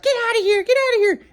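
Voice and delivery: high falsetto mock yell; mocking his ex yelling. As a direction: shoo him out in a high shrieking put-on voice. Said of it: "my ex is like".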